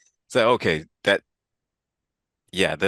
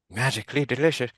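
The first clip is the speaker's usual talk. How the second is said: bad Irish accent; 5 semitones higher than usual. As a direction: overdo a leprechaun Irish voice. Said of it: "and here's my accent".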